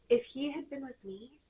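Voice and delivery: slow hushed mock-tragic whisper; milk the title's melodrama; mock dramatic reading.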